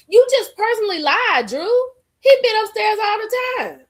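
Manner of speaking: loud high calling out. Real, not acted. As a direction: call out the lie loudly. Calling out a lie.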